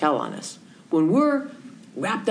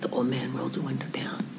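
ordinary talk

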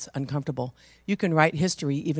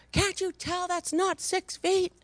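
mock screaming woman; 3 dB quieter, slower than usual. Frightened passenger scolds strangers, high mock shriek. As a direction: imitate a panicked woman scolding. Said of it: "started screaming at him".

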